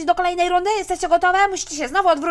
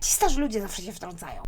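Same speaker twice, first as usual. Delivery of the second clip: grumbling aside; 9 semitones lower, 5 dB quieter than usual. Acted. Grumbling aside about mother.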